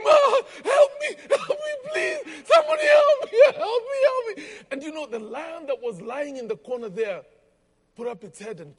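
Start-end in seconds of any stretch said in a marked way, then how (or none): 0.0-4.4 high, loud panicked screams for help; screaming for help. Retold or imitated. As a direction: shriek for help like a man trapped with a lion. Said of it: "And he began to scream".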